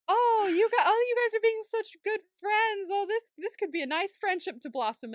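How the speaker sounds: mock-delighted, high and loud at first; hopeful gushing. Acting out her hopeful gushing at show.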